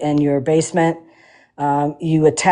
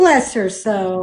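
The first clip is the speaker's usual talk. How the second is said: folksy Southern pity; 2 semitones higher, slower than usual. Retold or imitated. Mock Southern sympathy.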